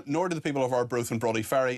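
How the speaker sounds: ordinary talk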